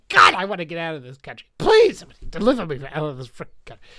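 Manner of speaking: loud, fast outburst of frustration; exasperated plea. Real, not acted. Vlogger begging to get out of the country, exasperated. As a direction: blurt it out, fed up and pleading.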